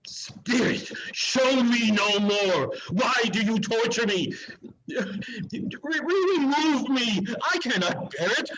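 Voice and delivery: high, cracking, tearful begging; anguished sobbing plea. Acted. Scrooge crying out to the Spirit to stop haunting him. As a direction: break down, begging for it to end.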